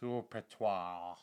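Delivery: slow, drawn-out mock French. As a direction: say it like a fake French word. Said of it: "It's French".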